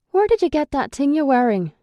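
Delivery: surprised man eyeing her shirt; Brennis voice. A narrator's reading. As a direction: question her outfit sharply. Brennis questions her outfit.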